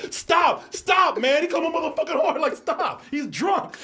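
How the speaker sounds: high, loud urgent shouting; shouting to stop. Retold or imitated. Recruits shouting to stop the beating. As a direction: yell to pull him off the fight.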